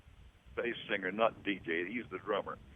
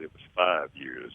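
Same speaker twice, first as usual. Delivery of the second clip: low slow voice; 8 semitones lower than usual. Retold or imitated. Correcting J D in retold scene.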